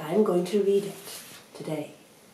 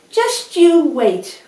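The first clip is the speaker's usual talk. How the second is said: defiant; 11 semitones higher, 14 dB louder, slower than usual. Acted. Tortoise defies the doubters.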